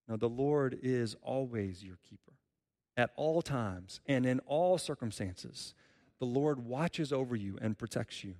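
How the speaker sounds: ordinary talk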